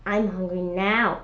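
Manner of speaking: ordinary delivery, nothing marked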